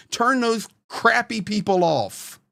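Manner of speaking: usual speaking voice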